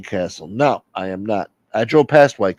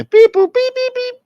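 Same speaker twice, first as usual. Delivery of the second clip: very high, loud beeping; imitated electronic beeps; 9 dB louder than usual. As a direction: squeal out robotic beeps.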